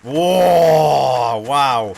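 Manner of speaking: long, booming "whoa" then "wow"; loud astonishment. Real, not acted. Streamer hearing a cow kicked his friend, loudly astonished.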